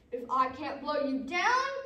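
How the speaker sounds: loud threat, climbing at the end; wolf's indignant big voice; frustrated wolf. Acted. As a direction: build loudly to the last word.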